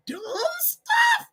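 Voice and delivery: very high, stretched-out disbelief; incredulous squeal. Real, not acted. Ranting at online squabblers, high squeaky disbelief. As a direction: squeal the words out in exasperation. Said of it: "That's how I gotta say it".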